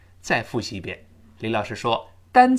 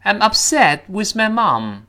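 slower, raised, carefully stressed model English; model sentence reading; 8 semitones higher, 6 dB louder, slower than usual. Shown as a demonstration. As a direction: read it out slowly and high for learners. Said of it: "再来一遍". (放慢、提高音调示范朗读)

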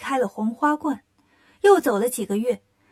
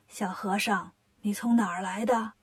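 put-on lower, softer kindly old man; old grandpa voice; 4 semitones lower, 6 dB quieter than usual. Old grandpa asks the little monk, softly. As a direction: speak like a gentle old grandpa.

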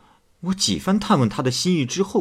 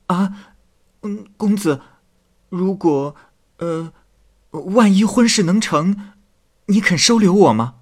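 put-on higher, louder young maid's voice, halting with hesitations; tearful maid pleading; 7 semitones higher, 6 dB louder than usual. Maid begs the scholar not to misjudge her, higher tearful plea. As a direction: plead with him, stumbling over the words.

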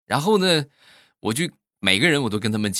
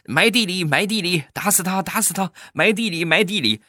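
much higher, chanting murmur; sleep talking; 10 semitones higher than usual. Retold or imitated. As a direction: chant the threat in her sleep. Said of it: "我媳妇儿在说梦话".

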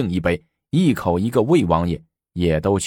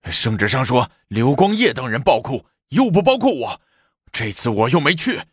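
high-pitched, agitated excuse; clamouring protest; 4 semitones higher than usual. A narrator's reading. Envoy dodging arrest pleads exclusion, clamouring protest. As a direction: protest your innocence, pitch climbing. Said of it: "一边嚷嚷着".

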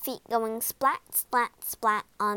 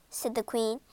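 ordinary delivery, nothing marked

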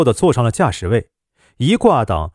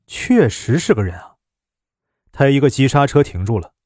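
usual speaking voice

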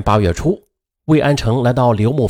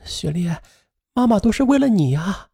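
crying; 7 semitones higher, 4 dB quieter than usual. A narrator's reading. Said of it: "刘牧飞哭着说".

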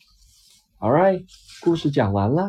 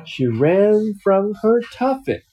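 lively picture-book reading voice; reading to a child; 6 semitones higher than usual. Acted. Dad reading a picture book to his child, storytelling voice. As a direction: read it out like a bedtime story for a little one.

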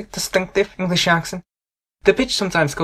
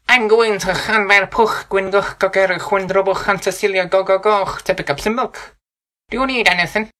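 nasal Welsh accent; 4 semitones higher than usual. Shown as a demonstration. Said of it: "Some Welsh speakers sound quite nasal".

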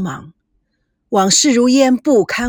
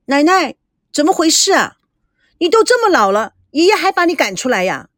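put-on higher, young granddaughter complaining; displeased girl; 6 semitones higher than usual. A narrator's reading. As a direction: protest to grandma, indignant and childlike. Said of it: "不满地说". (孙女不满地抱怨，声音偏高)

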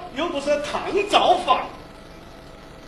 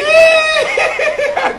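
a high, loud, shrieking burst of laughter; 13 semitones higher, 3 dB louder than usual. Acted. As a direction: explode into a squealing laugh. Innkeeper bursts out at name. (尖声爆笑)

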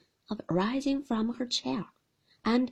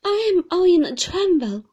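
high, loud trembling voice; nervous young mother falters; faltering; 10 semitones higher, 13 dB louder than usual. Acted. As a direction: let the words shake with fear. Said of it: "faltered my mother".

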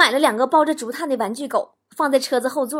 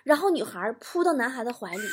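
ordinary talk

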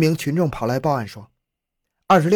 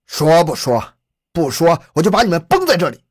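louder, higher-pitched angry interrogation; angry threat; 3 semitones higher, 5 dB louder than usual. A narrator's reading. Police chief threatening captured suspects to make them talk, angry. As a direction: threaten them loudly, pushing into the final words. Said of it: "马特气愤地掏出了手枪".

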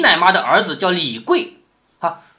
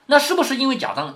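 normal talking voice

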